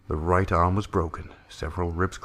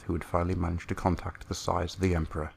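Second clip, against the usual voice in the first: usual speaking voice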